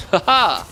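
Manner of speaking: loud high ha-ha laugh; burst of laughter. Real, not acted. Laughs at own mistake.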